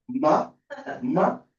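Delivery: MA, MA said in two separate syllables without closing the lips; lips not touching. Shown as a demonstration. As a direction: try "ma, ma" with lips kept apart. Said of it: "decir mamá sin pegar los labios".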